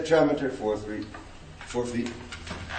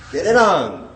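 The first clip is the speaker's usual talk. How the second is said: "Get it on" with the stress landing on "on", louder; anapestic stress; 5 semitones higher, 6 dB louder than usual. Shown as a demonstration. Teacher demonstrating an anapestic phrase, stressing the end. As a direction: punch the "on" at the end. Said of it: "is, uh, anapestic".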